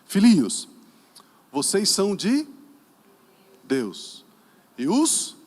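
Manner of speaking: loud reading with deliberate gaps; declaimed scripture. Real, not acted. Preacher reads the verse to the congregation, loud halting declamation. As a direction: call out each phrase and pause.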